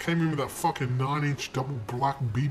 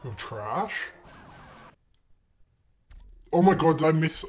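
usual speaking voice

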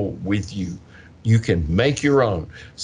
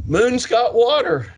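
put-on excited kid; 10 semitones higher than usual. Students heard in hallways.

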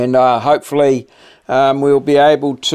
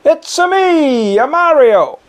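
bouncy cartoon Italian plumber; Mario voice; 12 semitones higher, 3 dB louder than usual. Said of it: "Here's Mario".

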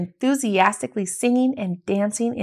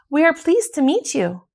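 calling back; 7 semitones higher, 4 dB louder than usual. A narrator's reading.

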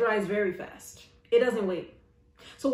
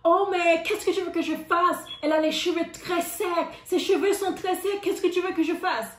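high, exasperated French retort; stylist in French; 6 semitones higher than usual. Retold or imitated. Stylist snapping back at the owner, high and exasperated. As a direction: shrug it off in French, pitched up. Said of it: "but it was in French".

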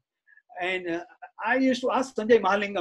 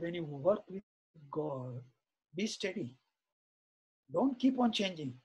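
soft quoted advice; 5 semitones lower, 10 dB quieter, slower than usual. Retold or imitated. Swami's calm advice to devotee.

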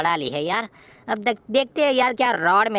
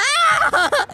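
high delighted laugh; burst of laughter. Real, not acted. Laughing at chaos.

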